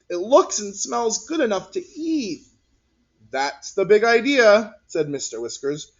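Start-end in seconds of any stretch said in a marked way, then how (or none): 0.1-2.5 whined. Acted. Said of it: "Martin whined". 3.3-4.8 teacher character voice. Acted.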